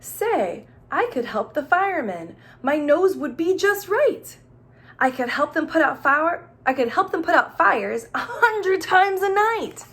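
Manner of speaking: excited read-aloud boasting; growing excitement. A narrator's reading. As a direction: pile on the boast eagerly. Storyteller building excitement.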